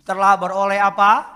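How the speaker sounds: loud prompting question. Acted. Prompts congregation to finish verse.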